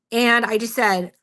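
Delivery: normal talking voice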